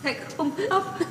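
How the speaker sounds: sobbing whisper. Acted. Mother to her departing son, sobbing softly. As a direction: whisper it while fighting back sobs. Mother holding back sobs.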